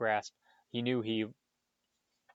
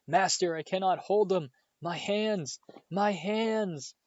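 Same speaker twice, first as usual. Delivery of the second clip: high, pained wail, much higher; agonised cry; 9 semitones higher, 4 dB louder, slower than usual. A narrator's reading. Quirrell crying to his master in burning pain. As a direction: cry out in panic as the hands burn.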